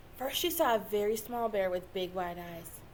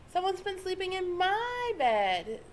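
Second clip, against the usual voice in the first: ordinary delivery, nothing marked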